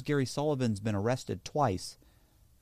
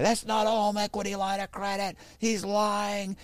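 higher, put-on accusing bank man; angry security man; 9 semitones higher than usual. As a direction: snap back that he's lying.

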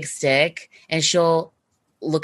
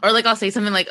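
ordinary talk

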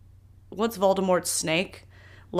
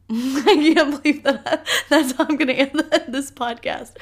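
laughing through the words, higher; can't stop giggling at what she just said; 7 semitones higher than usual. Real, not acted. Host laughing at her own confession to listeners.

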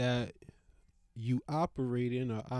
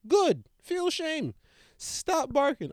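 shaming dog; 11 semitones higher, 4 dB louder than usual. Real, not acted.

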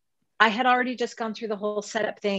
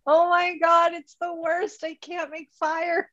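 high-pitched hammed-up wail of defeat; mock despair; 8 semitones higher than usual. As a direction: overdramatize a small camping disaster. Retelling her despairing reply to friend.

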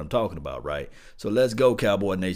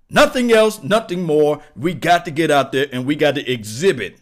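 loud hype; 6 semitones higher, 9 dB louder than usual. Real, not acted. Hype rant after deep voice.

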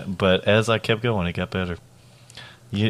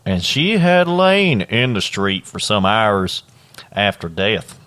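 put-on Southern accent. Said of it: "This is a great Atlanta accent".

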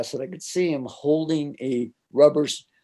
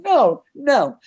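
high, louder protest; startled refusal; 14 semitones higher, 6 dB louder than usual. Retold or imitated. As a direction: push back in disbelief. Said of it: "I'm going".

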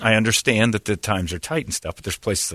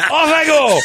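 mock shriek; 6 dB louder, slower than usual. Mock exit after line.

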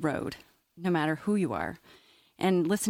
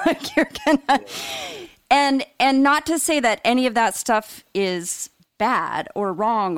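laughing; 4 semitones higher, 5 dB louder than usual. Real, not acted. Laughs at own poop joke.

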